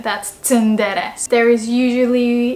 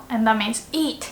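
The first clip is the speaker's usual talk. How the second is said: ordinary talk, nothing marked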